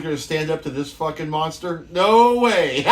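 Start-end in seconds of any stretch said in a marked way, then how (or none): 1.9-2.8 loud, higher laughing "no way"; can't hold back the laugh. Real, not acted. Laughing at his own story.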